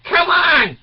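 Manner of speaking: high, loud urging bark; shouted come on. Real, not acted. Shouting at the audience to go live, loud. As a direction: yell it to push them out the door.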